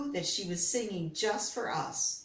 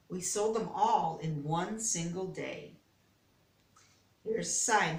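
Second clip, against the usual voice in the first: ordinary delivery, nothing marked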